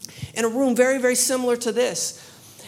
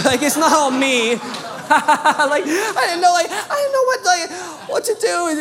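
high, loud laughing babble; 5 semitones higher, 5 dB louder than usual. Real, not acted. Preacher recalls his panic to the congregation, laughing loudly. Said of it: "laughing at own panic".

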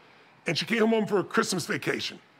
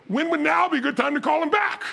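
high, emphatic leading question; insistent nudge; 9 semitones higher, 3 dB louder than usual. Retold or imitated. Dad urging daughter to retry busy line, insistent nudge. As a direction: push it harder, climbing to the end.

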